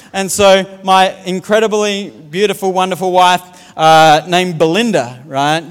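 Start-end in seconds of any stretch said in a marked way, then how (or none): none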